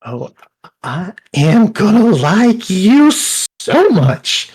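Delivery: high, slow, gushing delight; delighted cook. Acted. Cook thrilled by the mushroom offer, high and slow. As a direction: swoon over the offer.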